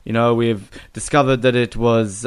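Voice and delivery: ordinary delivery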